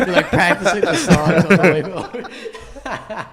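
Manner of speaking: slow, high, loud laughing; laughing tease. Real, not acted. Teasing Farhan about practicing songs, laughing slowly.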